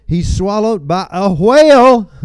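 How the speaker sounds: hammed-up loud amazement, stretching the words; incredulous shout. Real, not acted. Preacher marvels at Jonah's story, incredulous shout.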